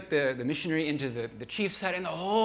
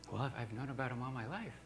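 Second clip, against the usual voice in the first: sheepish quiet answer; 3 semitones lower, 10 dB quieter than usual. Retold or imitated.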